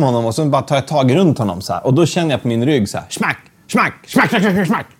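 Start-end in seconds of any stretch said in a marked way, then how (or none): 3.1-4.9 high, repeated impact sounds; quick comic smack-smack-smack; rapid-fire smacks. Retold or imitated. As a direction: imitate a volley of stings. Imitating repeated stings on back.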